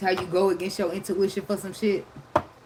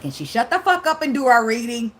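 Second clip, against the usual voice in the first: louder, higher exasperated mock complaint; annoyed clients; 6 semitones higher, 6 dB louder than usual. Scorpio clients fed up with her chatter, mock exasperation. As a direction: mimic fed-up clients snapping impatiently. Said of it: "They probably like".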